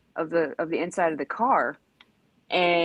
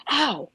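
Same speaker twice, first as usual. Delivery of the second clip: sudden ow; 7 semitones higher than usual. Retold or imitated. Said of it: "I instantly was like".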